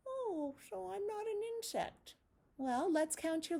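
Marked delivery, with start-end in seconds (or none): 0.1-2.1 high, curious little spider; puppet spider voice. Acted. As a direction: squeak it as a friendly puppet spider.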